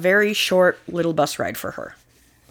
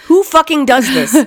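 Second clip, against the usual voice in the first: indignant outburst, louder; outraged; 6 semitones higher, 4 dB louder than usual. Real, not acted. Co-host fuming at a cruel father, outraged. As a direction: spit it out in disbelief.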